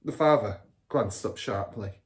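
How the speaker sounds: ordinary delivery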